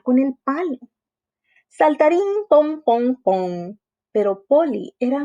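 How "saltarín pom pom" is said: high, bouncing pogo-stick sounds; bouncy sound effect. Acted. Narradora imita el palo saltarín. (efecto de salto, agudo y rebotado)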